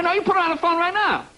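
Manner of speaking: high-pitched, hammed-up indignation; mock angry demand. Husband demanding his wife on the phone, mock angry. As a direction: demand it in comic outrage.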